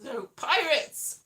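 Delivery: normal talking voice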